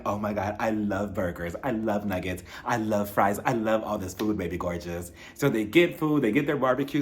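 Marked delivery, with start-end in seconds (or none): 0.0-5.1 low, soft, posh-ish gushing; gushing Lisa impression. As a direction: lower and softer, savouring every food she names. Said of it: "Lisa's like".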